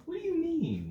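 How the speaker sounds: high-pitched incredulous question; mock bewilderment in a high voice; baffled reaction. Mimes own baffled reaction.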